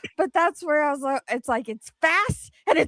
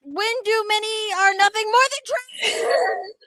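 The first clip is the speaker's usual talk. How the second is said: high, loud, hammed-up screaming read; screaming read with laughter breaking in; mock scream; 9 semitones higher, 7 dB louder than usual. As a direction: yell the comment like a crazed fan.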